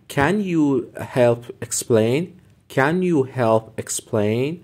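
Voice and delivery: very slow, clear teacher read; slow classroom demo; slow model phrase. Shown as a demonstration. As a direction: spell it out slowly for learners. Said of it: "بگو".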